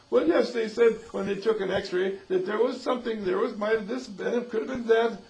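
high, loud, rambling confession; flustered admission. Acted. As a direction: blurt it out as the cornered patient. Acted patient's flustered confession.

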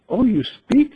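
acting out amazement, pitch shooting up; surprised. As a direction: marvel aloud at a talking creature. Voicing swimmer amazed bird talks.

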